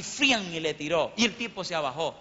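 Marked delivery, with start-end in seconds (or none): 0.0-0.5 high, sudden swishing blow sound; imitated machete swing. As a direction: mimic a blade slashing through the air. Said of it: "le hizo así". (imitación aguda de un machetazo)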